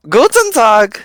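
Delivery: high, loud hearty German greeting; German accent. Acted. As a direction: boom out a friendly German hello. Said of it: "the German".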